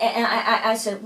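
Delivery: ordinary delivery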